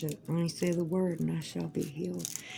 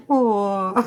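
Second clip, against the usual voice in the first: cooing aw; 3 semitones higher, 10 dB louder than usual. Retold or imitated. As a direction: melt at a cute face. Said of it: "it was like".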